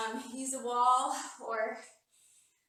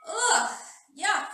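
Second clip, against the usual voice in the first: relieved groan; 7 semitones higher, 11 dB louder than usual. Real, not acted. End of hard squat hold.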